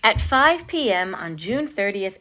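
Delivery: usual speaking voice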